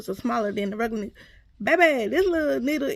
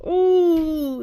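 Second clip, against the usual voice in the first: loud, high, drawn-out ooh; pained ooh; 6 semitones higher, 9 dB louder than usual. Retold or imitated. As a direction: re-enact crying out as the needle hurts. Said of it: "it hurt so bad".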